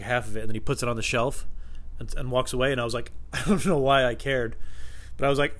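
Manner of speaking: ordinary delivery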